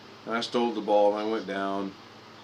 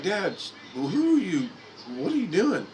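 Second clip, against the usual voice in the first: disbelieving what dad is doing; embarrassed kid; 10 semitones higher than usual. Retold or imitated. Embarrassed son asking dad what he's doing, incredulous. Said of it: "And I'm like".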